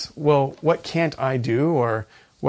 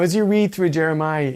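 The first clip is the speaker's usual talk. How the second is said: ordinary talk, nothing marked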